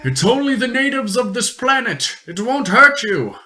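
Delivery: calm the panicking merchant; explorer voice. Acted.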